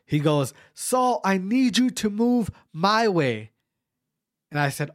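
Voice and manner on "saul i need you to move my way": slow, drawn-out words, pitched up; deliberate coach's instruction; simplified coaching instruction. Retold or imitated. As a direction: spell out each word slowly, imitating a coach. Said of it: "understood to just simplify".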